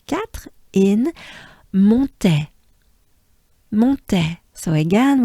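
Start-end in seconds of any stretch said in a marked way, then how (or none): 1.7-4.4 Montais with a nasal on, mon-TEH; French Montais. Shown as a demonstration. Teacher models Montais for students, nasal French. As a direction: say Montais through the mouth and nose. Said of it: "we have the on sound".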